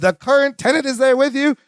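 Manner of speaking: high put-on TV host voice; Robin Leach. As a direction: impersonate a celebrity host, pitched well up. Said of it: "Robin Leach voice".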